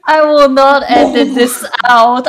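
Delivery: tipsy and giggling; loud tipsy giggling talk. Real, not acted. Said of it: "I am so tipsy right now".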